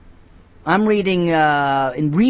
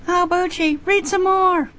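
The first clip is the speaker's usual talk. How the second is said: put-on high, childish begging; kids' voice. Nieces and nephews begging uncle to read. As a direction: whine for more like a little kid. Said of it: "That's my nieces and nephews".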